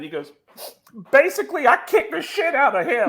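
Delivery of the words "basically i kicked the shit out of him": high, loud mock-tearful confession; imitated crying. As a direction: wail it like a man in tears. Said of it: "and he starts crying".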